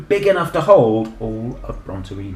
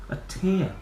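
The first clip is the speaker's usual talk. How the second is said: plain reading voice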